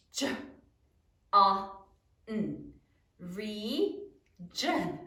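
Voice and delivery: J, A, N as separate low sounds, then Region; isolated sounds with pauses, blending into "Region"; sounding out. Shown as a demonstration. Teacher reveals the surprise spelling, sounding out. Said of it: "Sound it out".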